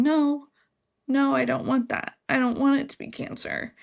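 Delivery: put-on high, pleading cry of scared children; a mother voicing her children's tearful protest, pitched high; kids crying. Kids hearing mom has cancer, imitated crying plea. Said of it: "both just started crying, and saying".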